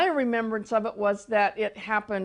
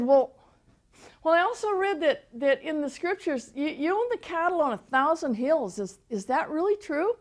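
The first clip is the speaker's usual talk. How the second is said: raised, higher voice asking a question; lady's eager question; 7 semitones higher than usual. Retold or imitated. The lady asking the Lord about the cattle, raised and eager. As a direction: pitch it up like an earnest questioner.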